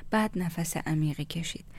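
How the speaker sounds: plain reading voice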